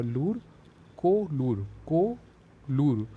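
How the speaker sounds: "लूर, को लूर" drawn out slowly, piecing together Kolleru; slow sounding-out of "लूर, को लूर" into Kolleru; slow syllable-by-syllable build. Shown as a demonstration. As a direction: spell out "को लूर" slowly, word by word. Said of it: "direct यहाँ word आ गया कोलेरू झील".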